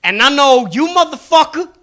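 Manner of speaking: high, cocky street con man; high-pitched con man sizing up a mark; mixtape salesman. Acted. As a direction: read them like a hustling salesman. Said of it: "And he goes".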